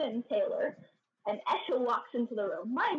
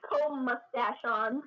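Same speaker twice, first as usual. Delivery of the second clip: usual speaking voice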